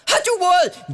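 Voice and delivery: high, loud furious yell; angry father. Retold or imitated. Father slaps son again, furious high yell. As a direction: demand the truth at the top of your voice.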